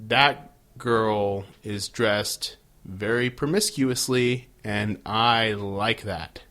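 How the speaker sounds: acted slow, low appreciative musing; slow ogling. Host voicing Angel eyeing a woman in a bar, slow ogling. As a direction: play a man slowly savouring what he sees. Voices drunk Angel's admiring thought.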